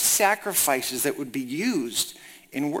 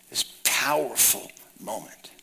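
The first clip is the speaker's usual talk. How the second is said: hushed, slow whisper; 7 semitones lower, 5 dB quieter, slower than usual. Real, not acted. Preacher to congregation on God's promise, whispering. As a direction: let it drop to a breathy hush.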